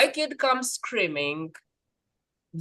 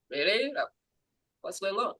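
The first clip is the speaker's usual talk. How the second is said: unruffled reply; 8 dB quieter than usual. Acted. Unruffled reply to someone screaming.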